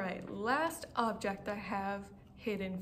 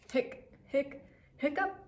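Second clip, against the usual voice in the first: hiccups; 5 semitones higher than usual. Acted. Clifford hiccups again.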